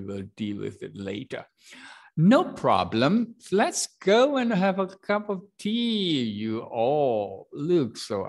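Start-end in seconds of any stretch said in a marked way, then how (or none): 2.1-6.2 much higher and louder, slow no problem; hearty reassurance. Shown as a demonstration. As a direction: invite them warmly to tea. Teacher voices Uncle Ellie.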